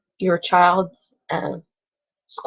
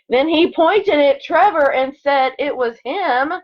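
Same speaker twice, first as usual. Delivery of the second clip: lively storybook read-aloud; expressive picture-book narration; reading to children; 7 semitones higher, 5 dB louder, slower than usual. Acted. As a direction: read it like story time for second graders. Reads picture book to class.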